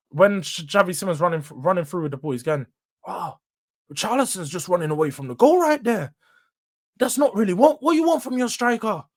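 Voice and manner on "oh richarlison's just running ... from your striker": put-on a light Scottish commentator accent; Ali McCoist Scottish accent. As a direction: lightly mimic a Scottish pundit. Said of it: "in his accent".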